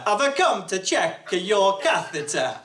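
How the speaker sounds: Italian accent sliding into a cartoon Count; hammed-up Italian nurse accent; bad Italian accent. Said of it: "my Italian accent sounds like the Count".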